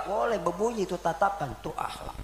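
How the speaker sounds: whispered aside. Real, not acted. Preacher whispering to the congregation about manners. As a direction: breathe it out without voice.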